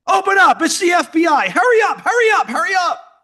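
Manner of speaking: reenacted agent shouting. Speaker, reenacting the agents at his door, shouting. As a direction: hammer out the orders like a raid.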